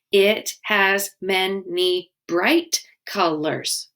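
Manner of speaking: It has many bright colors drawn out, bright stressed; slow syllable count. Shown as a demonstration. Teacher fixing the haiku line, slowly counting.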